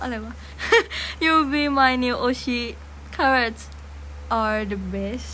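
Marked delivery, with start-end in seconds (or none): 1.2-5.3 hammed-up careful English accent, very slow and louder; อ่านภาษาอังกฤษแบบตั้งใจโชว์สำเนียง; English accent read. Shown as a demonstration. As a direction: read the comment in show-off English. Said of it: "อ่านสำเนียงดีๆฟัง".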